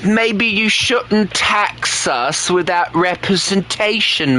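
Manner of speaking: high, slow teasing; high mock taunt. Streamer taunting British chat about taxes, high and slow.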